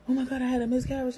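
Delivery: gasp, then high loud panicked cry. Retold or imitated. Seeing the empty ultrasound, gasping high panic. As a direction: gasp and panic like the worst happened.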